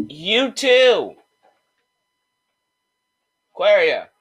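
high, loud call with a falling end. Real, not acted. Host calls out to Aquaria to stop, loud holler. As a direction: holler to get someone's attention. Calling out to someone nearby.

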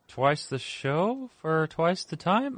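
half-speed talk. Pretend. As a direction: talk like a slowed recording. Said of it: "listen to our show on half speed".

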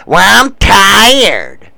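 slow, dragged-out put-upon whine; whiny girlfriend. Retold or imitated. Girlfriend China Doll excusing herself, slow whine. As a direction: drawl it like she can't be bothered. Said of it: "she does this mumbling shit".

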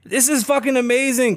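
ordinary talk, nothing marked

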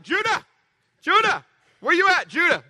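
high anxious calls of "Judah"; calling out. Retold or imitated. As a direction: call the boy's name across the house. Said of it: "I'm screaming at the top of my".

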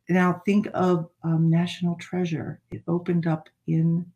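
ordinary talk

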